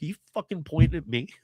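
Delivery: aggressive, fast confrontational snap; angry comeback. Retold or imitated. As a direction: act out a stranger picking a fight. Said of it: "would turn back to you and be like".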